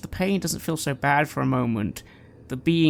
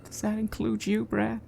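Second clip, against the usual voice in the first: fragile plea; 6 semitones higher than usual. Acted. Nigel quietly asks forgiveness.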